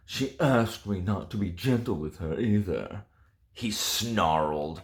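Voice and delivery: a hint of sultry audiobook reading; dramatic reading aloud. Reviewer reading a steamy passage to her viewers, lightly dramatic. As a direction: read the romance line with mock seduction.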